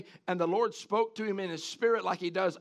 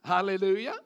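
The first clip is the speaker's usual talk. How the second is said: ordinary talk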